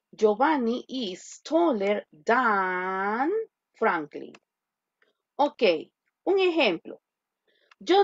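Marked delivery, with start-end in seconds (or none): 0.1-4.3 slow, drawn-out model sentence; slow dictation. Shown as a demonstration. Teacher repeating the model sentence slowly. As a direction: read it out slowly for students. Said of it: "teacher modeling sentence".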